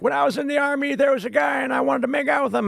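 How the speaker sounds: creaky grandpa confessing, cartoon-style; Abe Simpson. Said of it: "Abe was like".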